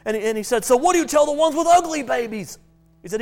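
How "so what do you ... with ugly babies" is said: high, loud cheeky question; lightly voiced father; curious father. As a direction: push for the punchline. Joke, father asks.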